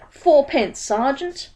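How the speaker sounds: high, eager street-hawker voice; bright-eyed salesman, pitched high; Dibbler the pieman. A narrator's reading. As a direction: pitch it up like a pushy pie seller. Dibbler answers.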